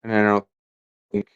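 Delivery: long gaps between fragments; disjointed, stop-start speech; halting, breaking up. Real, not acted. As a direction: stall mid-sentence, words dropping out. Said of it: "Sounds like he's actively having a stroke".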